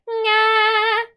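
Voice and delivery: high nasal nga. Shown as a demonstration. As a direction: ring the nga in the nose. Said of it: "If you're gonna do an a".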